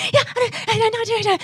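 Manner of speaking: high, rapid gabbled chatter; fast-talking Irish woman. Retold or imitated. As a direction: imitate breathless non-stop Irish women's talk. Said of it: "we talk so fast".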